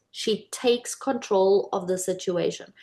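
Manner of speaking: normal talking voice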